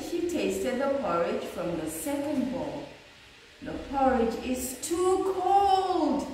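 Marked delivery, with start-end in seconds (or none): 3.6-6.3 high sing-song complaint, higher voice; a sing-song cry of a little girl. A narrator's reading. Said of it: "she cried".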